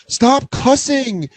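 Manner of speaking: high, slow mock kid yell. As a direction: stretch it out like a scolding child. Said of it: "I just heard one of the kids go".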